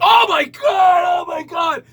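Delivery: very high, loud, slow shout; panicked oh my god. Retold or imitated. Customer panics at the waiter's spit, high shouting. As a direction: freak out at the spit. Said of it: "I went".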